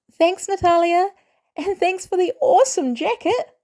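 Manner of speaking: put-on a thrilled boy gushing over a gift; excited kid. Boy thanking Natalia for the jacket, thrilled.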